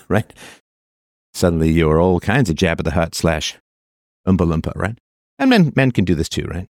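ordinary talk

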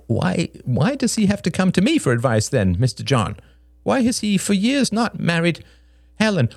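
high, insistent protesting man; agitated Greg. A narrator's reading. Narrator voices agitated Greg.

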